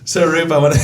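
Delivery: cracking up mid-sentence; laughing. Real, not acted. Host laughing at his own raspy voice. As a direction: laugh it out, voice giving way. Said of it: "now my voice is gonna go raspy".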